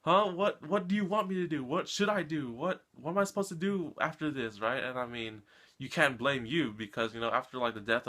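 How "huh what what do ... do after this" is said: angry and confused. Retold or imitated. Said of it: "Yu is just so angry and confused".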